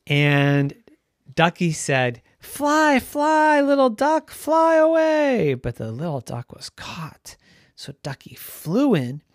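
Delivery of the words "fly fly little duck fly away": high, loud urging, last words stretched; urgent calling out. A narrator's reading. Ducky urges the trapped duckling to escape, calling loudly. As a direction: call across to get it moving.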